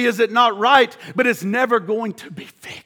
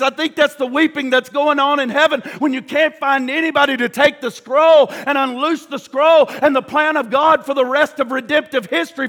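ordinary talk, nothing marked

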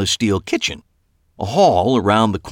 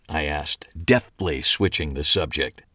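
ordinary delivery, nothing marked

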